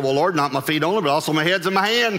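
overeager Peter. Acted. Peter reverses himself to Jesus, hammed-up eagerness. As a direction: tumble it out in mock eagerness.

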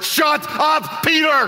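high, comic yell at Peter; exasperated mock shout. God cuts off talkative Peter, mock exasperated shout. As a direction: holler it as a hammed-up divine interruption.

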